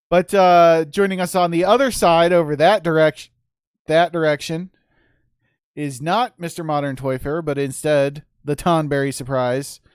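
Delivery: big, high ring announcer intro. Acted. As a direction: boom it out like an arena host.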